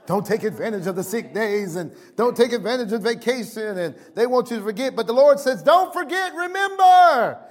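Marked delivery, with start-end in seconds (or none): 5.6-7.4 high, ringing quote of the Lord's command; emphatic proclamation. Acted. Preacher voicing the Lord's command to the congregation, emphatic proclamation. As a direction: proclaim it high, stretching the last word.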